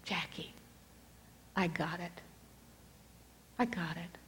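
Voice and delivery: soft, hushed inner voice of God; still quiet voice. Retold or imitated. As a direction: speak it gently, as if heard only inside. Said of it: "quiet voice inside of me of God".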